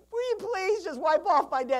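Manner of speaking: high, loud begging plea; whiny pleading customer voice; pleading debtor. Pretend. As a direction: beg the bank like a desperate debtor.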